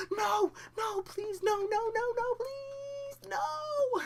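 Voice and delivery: very high, loud mock wailing; exaggerated begging. Teen begging partner not to stop, overblown screaming. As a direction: plead in a hammed-up shriek. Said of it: "I wasn't like that".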